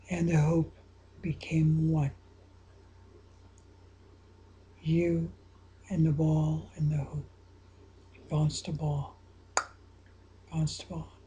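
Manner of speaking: low, soft hushed guiding voice; guided visualization. Acted. Coach guiding a listener through a free throw, hushed and soft. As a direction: ease them into focus, half whispered.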